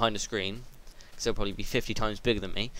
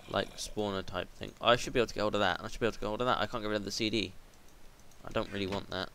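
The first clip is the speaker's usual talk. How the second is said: ordinary talk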